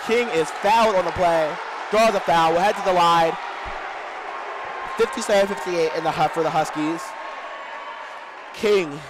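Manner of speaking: fast, loud live game calling; play-by-play. Acted. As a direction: call the action as it happens, building.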